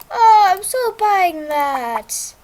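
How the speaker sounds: loud, drawn out delight; thrilled, slow and loud; excited. Real, not acted. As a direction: gush over the item you want. Excited at the shop item.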